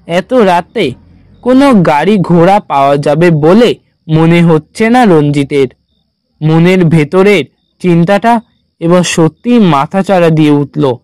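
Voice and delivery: plain reading voice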